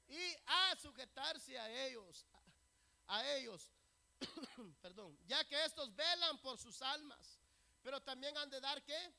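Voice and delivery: loud, high-pitched sermon delivery; full-force preaching. Real, not acted. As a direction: preach it out to the congregation. (predicación a toda voz)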